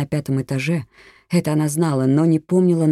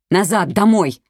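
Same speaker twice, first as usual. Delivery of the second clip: loud, raised-pitch command; urgent shout; 5 semitones higher, 5 dB louder, slower than usual. A narrator's reading. Policewoman warning an old neighbour mid-fight, urgent shout. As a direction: shout a sharp order to get back. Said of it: "крикнула ей Елена".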